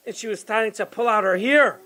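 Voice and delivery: usual speaking voice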